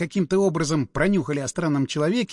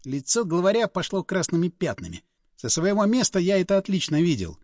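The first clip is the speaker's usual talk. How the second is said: ordinary delivery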